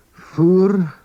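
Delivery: Fur spoken high and loud, held out; Manx word modelled. Shown as a demonstration. Teacher to learners, modelling Fur high and clear. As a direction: present Fur for learners to repeat. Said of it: "teacher models vocabulary".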